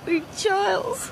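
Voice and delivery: slow, weepy gush; welling up with gratitude; tearful. Acted. Girl moved by Charles's bullying story, starting to cry. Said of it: "she's off again".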